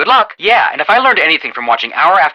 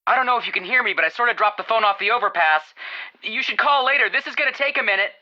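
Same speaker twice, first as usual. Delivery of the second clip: phone call; 6 semitones higher, 4 dB quieter than usual. Acted. Caller on bad phone line.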